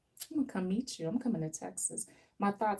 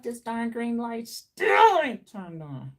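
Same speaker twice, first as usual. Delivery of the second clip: louder, slow, fed-up yell at the light; frustrated shout; 4 semitones higher, 8 dB louder, slower than usual. Real, not acted. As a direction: drag it out like you're sick of waiting. Stuck at red light.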